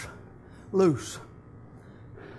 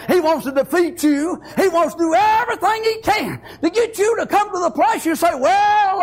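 loud, high, fervent pulpit build; preaching at full force; 7 semitones higher, 4 dB louder than usual. Acted. Preacher warning the congregation the devil fires live ammunition, full-force preaching. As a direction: rise and hammer it home like a revival preacher.

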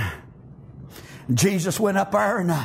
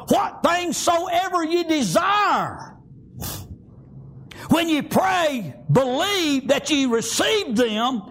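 emphatic sermon with stretched scripture words; fervent preaching; 8 semitones higher than usual. Real, not acted. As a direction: hammer home who said it. Preaching with force.

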